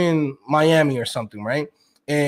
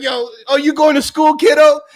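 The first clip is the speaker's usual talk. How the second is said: higher, louder mock shout of a smug rich guy; condescending shout; 9 semitones higher, 5 dB louder than usual. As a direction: talk down to the kid, hammed up. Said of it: "he's like".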